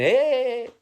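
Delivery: sudden high startled yelp; startled hey. Acted. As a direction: jump in pitch as if caught out. Surprised outburst in a sketch.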